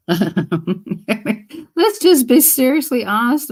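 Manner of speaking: slow words through laughter; laughing. Real, not acted. Joking with viewers about names, laughing slowly.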